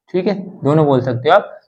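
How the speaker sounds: ordinary talk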